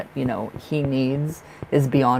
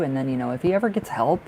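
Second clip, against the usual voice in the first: normal talking voice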